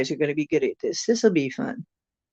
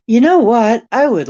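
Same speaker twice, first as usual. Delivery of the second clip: excited; 6 semitones higher, 10 dB louder than usual. Real, not acted. Excited idea to visit Denmark.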